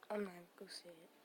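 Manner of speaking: ordinary talk, nothing marked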